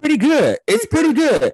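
high, sing-song boast; playful brag. Real, not acted. Answering Rob about the weed, high and playful.

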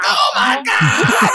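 slow, high horrified yell; drawn-out cry of disgust; shocked shout. Retold or imitated. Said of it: "And they're like".

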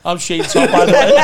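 loud, laughing imitation of Kenny; mock announcement. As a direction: announce it proudly after each joke. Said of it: "he went".

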